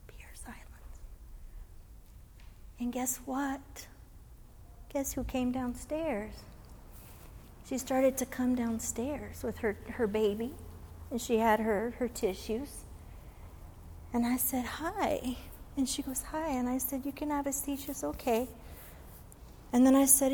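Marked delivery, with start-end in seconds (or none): 0.1-1.0 hushed unvoiced whisper of silence. Real, not acted. Chaplain telling the room fell quiet, whispering. Describing hush after song. 2.8-18.5 soft, slowed, gentle storytelling; hushed tender telling. Real, not acted. Chaplain recalling the widow coming downstairs, hushed and tender.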